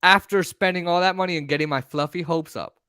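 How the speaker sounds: ordinary talk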